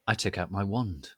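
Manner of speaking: ordinary delivery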